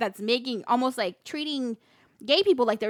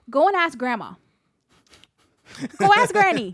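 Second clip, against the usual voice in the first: louder, brushing the question off; mom deflecting; 6 semitones higher, 6 dB louder than usual. Retold or imitated. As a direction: pass the question along quickly. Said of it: "she says".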